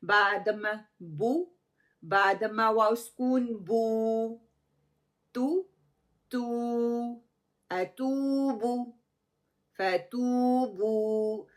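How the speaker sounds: बू, तू, फतूबू said slowly with long ऊ held two counts; slow letter drill on बू and तू; damma length demo. Shown as a demonstration. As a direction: stretch ऊ in फतूबू evenly. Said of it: "कैसे होगा?".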